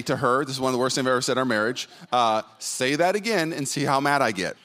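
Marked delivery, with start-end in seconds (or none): none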